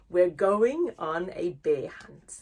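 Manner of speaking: plain reading voice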